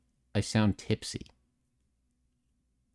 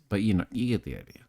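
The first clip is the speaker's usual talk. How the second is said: ordinary talk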